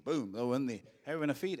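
ordinary delivery

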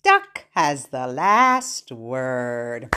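slow closing line with stretched words; drawn-out ending. Acted. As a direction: stretch out the rhyme's last line.

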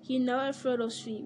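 normal talking voice

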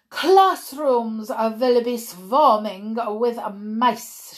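put-on loud, slow accented proclamation; witch's shout. Grand High Witch rousing the witches, loud accented shout. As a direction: proclaim it loudly, stretching the words. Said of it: "shouted the Grand High Witch".

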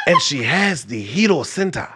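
a light Wakandan king voice. Acted. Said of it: "you sound like an audiobook".

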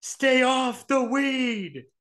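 pundit warning players off weed, high and slow; Stephen A Smith. Retold or imitated. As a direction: drag each word out like a lecturing sports pundit. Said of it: "how Stephen A Smith is always like".

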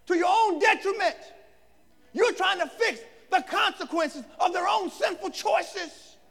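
high, loud exhorting preacher; full-force preaching. Real, not acted. Preacher confronting those with a Messiah complex, full-force preaching. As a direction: press each point on the congregation.

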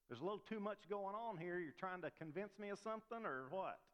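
lightly acted suspicion of someone overselling; mock skeptical. Acted reply to someone protesting too loudly.

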